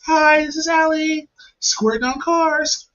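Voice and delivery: put-on high, drawn-out girly voice; put-on high feminine sales pitch; cam-girl intro. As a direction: chirp it like a perky camgirl, sustained and high.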